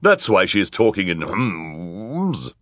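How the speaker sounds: slow, drawn-out wicked woman's voice; villainess covering up. A narrator's reading. Villainous woman explaining the hums to the crowd, drawn-out lie. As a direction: draw out the excuse in character.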